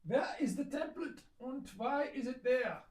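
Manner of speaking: loud, high-pitched mock German accent. Maker hunting for the template, mock German accent. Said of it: "broke into a German accent".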